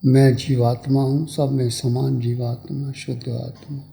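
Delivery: calm, spaced-out phrases settling into a low voice; guided meditation. Real, not acted. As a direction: guide them inward, letting phrases sink and fade.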